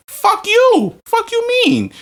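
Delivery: high-pitched angry retort. Retold or imitated. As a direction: fire it back high and outraged. Retold fight with ex-girlfriend.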